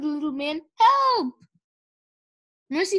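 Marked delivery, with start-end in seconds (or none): 0.8-1.3 high, loud yelp for help; cry for help. A narrator's reading. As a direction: call out, pinned under a pig. Pig sitting on him.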